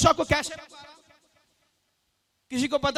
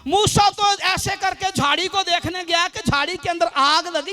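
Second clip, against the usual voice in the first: ordinary delivery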